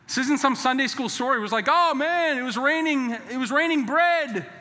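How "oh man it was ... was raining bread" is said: much higher and louder, in awe; amazed excitement. Pretend. Israelites marvelling at bread raining down, excited awe. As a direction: marvel at bread falling from the sky.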